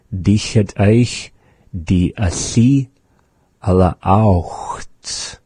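Dich het euch die Asi erlaucht said slowly as a question; slow phrase demo. Shown as a demonstration. Teacher demonstrating the question phrase. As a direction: model the phrase word by word. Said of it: "So when we say".

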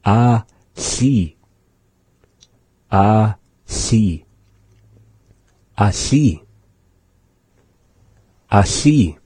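"Ah, si" said slowly with the lateral hiss; slow repeated teaching demo of "Ah, si"; pronounce asi. Shown as a demonstration. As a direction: repeat "Ah, si" for the class, air out the side of the mouth. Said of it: "meaning coming from the side".

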